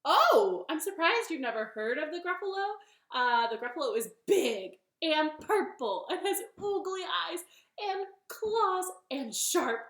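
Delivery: high, loud, emphatic bunny; bunny character. Acted. Bunny describes Gruffalo to snake, high and emphatic. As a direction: pile up the monster's features. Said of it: "And Bunny said".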